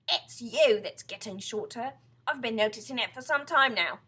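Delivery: loud, pointed accusation; goading Mr Twit. Acted. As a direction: break bad news with relish. Voices Mr Twit goading his wife.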